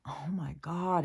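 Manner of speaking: breathy whisper of disbelief. Retold or imitated. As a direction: hush it out as if stunned. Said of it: "I was just like".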